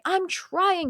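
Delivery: imitated high-pitched frustrated protest. Retelling her near-protest over healing, frustrated. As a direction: protest in exasperation, pitch jumping up. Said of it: "choose to be frustrated and say".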